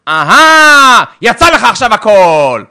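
loud, slow, taunting cry; mock accusation. Spouse accusing the other after a fight, loud and taunting. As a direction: throw it at him.

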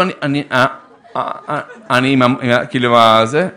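ordinary talk, nothing marked

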